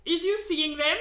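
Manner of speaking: high, loud giant question; the giant pointing out the others; giant asking. A narrator's reading. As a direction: ask eagerly as the giant. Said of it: "the B F G asked".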